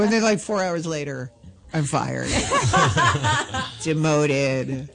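usual speaking voice

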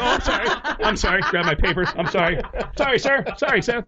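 high, hurried little apologies; meek apologizing. Retold or imitated. Comic thrown out by his boss, high meek apologies.

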